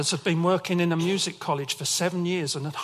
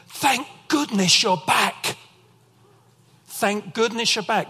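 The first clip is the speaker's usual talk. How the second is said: relieved; 6 semitones higher, 5 dB louder than usual. Retold or imitated. Panicked neighbours retold.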